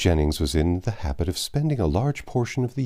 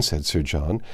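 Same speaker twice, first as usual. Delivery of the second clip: plain reading voice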